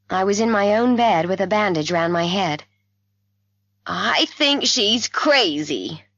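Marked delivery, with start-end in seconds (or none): 3.9-6.0 slow, higher-pitched distress; very upset. A narrator's reading. Mother complaining to the doctor about her daughter, very upset. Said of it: "very upset".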